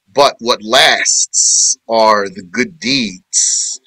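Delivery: slow, deliberate gloss of the verse; slow explaining voice; slow translation. Acted. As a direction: lay each word down slowly.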